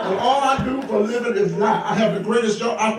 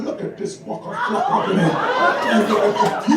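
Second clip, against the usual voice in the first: slow, drawn-out sly jab at the bishop; mock church remark; 5 semitones higher, much slower than usual. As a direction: savour each word like a secret curse. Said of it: "I said".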